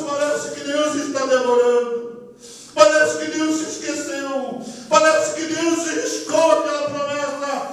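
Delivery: high, slow, stretched lament; high preaching cadence; impassioned preaching. Real, not acted. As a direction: draw out each repeated phrase from the pulpit. Preaches at full force.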